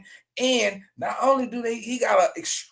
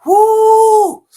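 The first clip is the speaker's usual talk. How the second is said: high, loud hype whoop; excited whoop; 14 semitones higher, 12 dB louder than usual. Real, not acted. Host hyping his viewers with a loud whoop. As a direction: let out a big celebratory woo.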